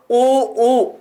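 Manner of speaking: "ऊ, ऊ" held with rounded lips; rounded-lip vowel demo. Shown as a demonstration. Teacher shows students the lips' shape, "ऊ, ऊ" rounded and held. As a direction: round the lips and sustain each "ऊ". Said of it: "ओष्ठों का आकार कैसा हो गया".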